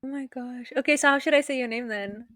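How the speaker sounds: normal talking voice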